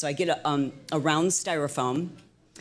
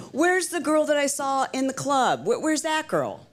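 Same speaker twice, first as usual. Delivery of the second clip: high-pitched mock demand; imitating exasperated director; 10 semitones higher than usual. Director demanding the girl from the club, mock exasperation. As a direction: mimic a worked-up director's challenge. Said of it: "she came storming into the room".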